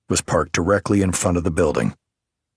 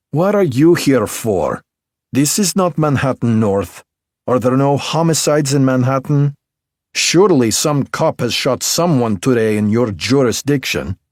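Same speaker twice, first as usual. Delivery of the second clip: put-on higher, louder Belarusian-accented woman, sharp and teasing; 7 semitones higher, 5 dB louder than usual. A narrator's reading. M.E. technician Alina needles the detective in Belarusian accent.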